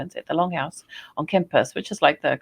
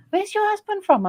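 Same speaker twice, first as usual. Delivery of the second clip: much higher, curious voice of the wife; imitating the wife; 4 dB louder than usual. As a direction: ask it in the wife's high voice. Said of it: "And she's like".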